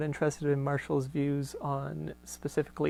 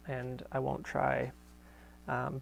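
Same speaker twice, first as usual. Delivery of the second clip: ordinary delivery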